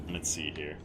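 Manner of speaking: usual speaking voice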